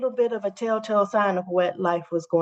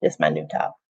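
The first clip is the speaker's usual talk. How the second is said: low voice; 14 semitones lower, faster than usual. Pretend. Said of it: "I got a new shirt".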